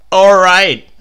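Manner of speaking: loud, high angry shout. A narrator's reading. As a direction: yell it at his wife, fed up. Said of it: "he shouted".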